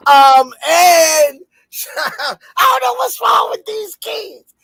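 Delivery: high, laughing fake sob; half-laughing whine about the kids; mock crying. Mock crying complaint about interruptions.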